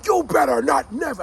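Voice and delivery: loud, high-pitched angry shout; father yelling. Retold or imitated. Father scolding his son inside the house, retold yelling. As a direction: imitate a dad's yell through the walls. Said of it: "I hear the father's voice yelling".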